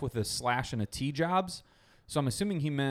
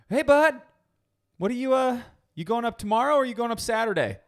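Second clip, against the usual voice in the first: higher, louder, fast mom on the phone; bubbly mom voice, higher and louder; 10 semitones higher, 6 dB louder, faster than usual. Retold or imitated. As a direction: play the chatty mom calling.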